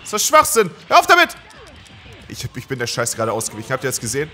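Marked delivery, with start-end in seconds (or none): 0.0-1.3 from loud complaint to high, very loud yell; rising rage. Real, not acted. Gamer furious his combo did nothing, rising rage. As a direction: blow up at the cheating boss. Combo wasted, raging at boss.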